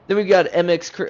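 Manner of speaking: hyped announcer intro. Real, not acted. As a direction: launch the name like a race caller. Hype intro, cut off, restarted.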